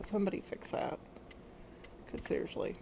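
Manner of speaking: soft whispered grumble; whispered mutter. Real, not acted. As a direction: mutter it under your breath, annoyed. Annoyed aside to herself.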